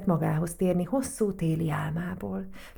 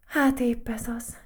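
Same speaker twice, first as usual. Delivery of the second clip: higher-pitched, mildly rueful sigh; sighed reply; 6 semitones higher than usual. A narrator's reading. Matild sighing over the threatened dust bunnies, mildly rueful. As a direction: let it out with a light sigh. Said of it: "sóhajtott Matild".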